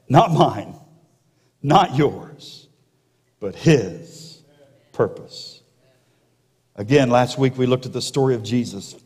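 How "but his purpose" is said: "his" drawn out and set apart before a hushed "purpose"; stress on HIS. Shown as a demonstration. Preacher contrasting God's purpose with ours, stress on "his". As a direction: land on "his", pause, then drop off on "purpose". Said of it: "with a different emphasis".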